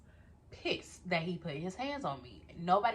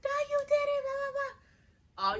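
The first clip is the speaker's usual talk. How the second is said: reenacted furious yelling, much higher; high-pitched angry outburst in the studio. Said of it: "I'm like".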